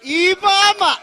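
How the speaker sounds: loud, high call to the crowd; shouted rally cry. Real, not acted. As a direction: belt it out to fire up the rally. Interpreter rousing the rally crowd.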